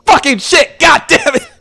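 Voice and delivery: high, loud startled yelp of curses; startled shout. Acted. Kalith startled by a touch on the shoulder, shrieking curses. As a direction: jump out of your skin and swear.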